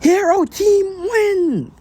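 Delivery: very high, slow, drawn-out hero announcer; All Might announcement. Retold or imitated. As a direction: stretch it out like a booming hero. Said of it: "All Might would be like".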